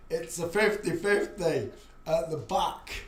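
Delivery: slow projected classroom voice. Shown as a demonstration. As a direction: call it out so the back can hear. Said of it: "Do you hear me now?".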